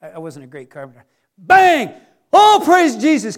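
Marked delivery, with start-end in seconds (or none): 1.4-1.9 loud, high imitated whack; hammer bang. Carpenter whacking his thumb, loud imitated bang. 2.3-3.3 loud, high, stretched-out outburst; exclaimed praise. Acted. Carpenter who just hit his thumb, loud exclaimed praise. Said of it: "came out of my mouth instead of something else".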